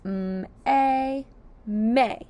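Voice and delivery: "M-A" as separate sounds, then "may" blended; sounding out letters. Shown as a demonstration. Teacher sounding out a word for young readers, slow and clear. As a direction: stretch each sound, then join them. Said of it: "Let's sound out may".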